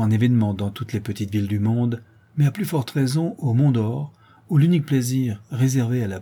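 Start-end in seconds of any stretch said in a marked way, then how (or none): none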